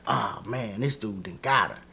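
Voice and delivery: low, soft muttered reaction; soft and low, half to yourself; hushed. Retold or imitated. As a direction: keep it down, like you can't believe it. Reacting in disbelief to the show.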